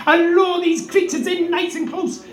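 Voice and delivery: usual speaking voice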